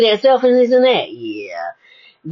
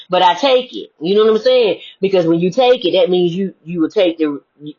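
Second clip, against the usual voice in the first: ordinary delivery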